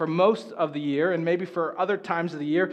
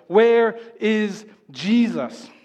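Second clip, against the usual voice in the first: drawn-out, louder worried call; mock panic; 3 semitones higher, 4 dB louder, much slower than usual. Disciples wake to find Jesus gone, mock panicked call. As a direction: stretch it out like a panicked search. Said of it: "They're like".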